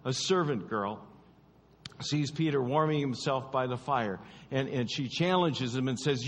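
ordinary delivery

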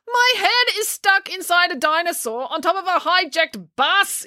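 high-pitched indignant yell. A narrator's reading. Finn, head stuck in the dinosaur, yelling. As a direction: protest in a high, mock yell. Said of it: "yelled Finn".

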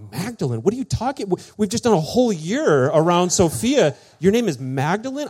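high, loud, incredulous outburst; confused disbelief. Retold or imitated. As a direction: sputter as if everything just collapsed. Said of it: "I'm, like, so confused".

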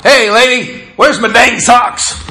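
high, loud teasing holler to the kitchen; playful yell. Retold or imitated. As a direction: call out to the kitchen with a grin. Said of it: "He, he yells out".

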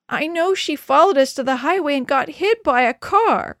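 high, loud, distraught wail; anguished child's outburst; sobbing outburst. A narrator's reading. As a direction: cry out as if sobbing. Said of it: "Denny was sobbing".

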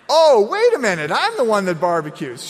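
loud, high mock dawning shock; the penny drops, loudly; hammed-up surprise; sudden realization. Dad's realization, retold.

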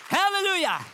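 high, ringing hallelujah; preacher's high exclamation of praise; exultant praise. Real, not acted. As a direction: cry it out in praise. Praise shout in fervent preaching.

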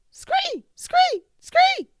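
very high, loud squawking bird cry. Acted. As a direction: shriek like a startled jungle bird. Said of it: "the bird squawked".

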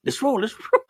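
high, loud mock disbelief with a laugh; imitating her disbelief. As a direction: play the half-asleep wife, baffled and high. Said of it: "She fucking looked at me like".

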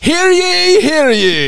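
high, loud proclamation call; town crier. Pretend. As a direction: cry it out like a herald. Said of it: "Clinton's Court".

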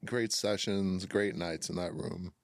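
normal talking voice

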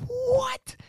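high squeaky startled what; shocked what. Retold or imitated. Shocked Victor is there.

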